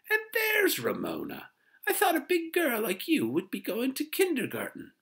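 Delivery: very high, loud put-on busybody voice; gushing neighbor. A narrator's reading. Neighbor addresses kindergarten-age girl, gushing high voice. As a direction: coo loudly at a child in piercing high pitch. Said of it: "exclaimed Miss Whisper".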